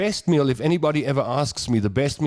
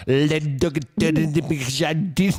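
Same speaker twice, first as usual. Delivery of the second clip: mock French; 3 semitones higher than usual. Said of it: "you're like".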